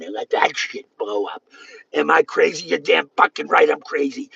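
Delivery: ordinary talk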